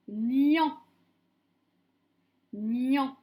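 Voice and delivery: loud, drawn-out dictated syllable; dictation voice. Shown as a demonstration. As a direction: dictate it firmly to a class, stretching the syllable. Teacher dictating syllables to pupils.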